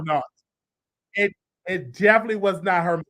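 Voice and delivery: ordinary talk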